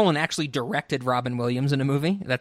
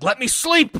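imitating Al Pacino; 10 semitones higher than usual. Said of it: "Al Pacino's whole thing".

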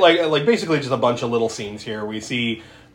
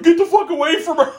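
high, loud laughing shout; hammed-up angry yell; mock yell; 5 dB louder, faster than usual. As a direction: bark it like a panicked man, half laughing. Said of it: "he's like".

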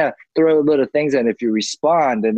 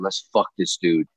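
two-faced aside; 7 semitones lower, 4 dB quieter than usual. Retold or imitated. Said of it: "And it, it turns around".

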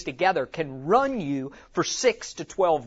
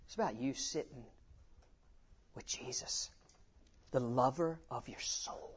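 hushed intimate reminder; 4 semitones lower, 15 dB quieter than usual. Retold or imitated. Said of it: "we never move away from".

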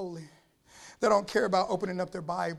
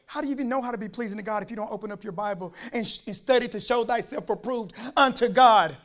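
ordinary delivery

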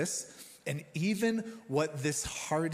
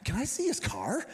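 put-on higher, eager child's voice; little son; 8 semitones higher, 4 dB louder than usual. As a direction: play the excited four-year-old. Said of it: "So he's like".

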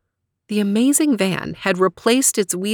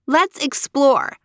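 put-on eager child, slow and higher; little girl voice; 6 semitones higher, slower than usual. A narrator's reading. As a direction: suggest it like a curious kid. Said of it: "suggested Leanie".

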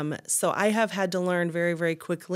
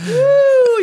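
high woo; 16 dB louder than usual. Real, not acted. Exhale after laughing fit.

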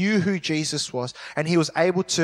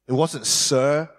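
usual speaking voice

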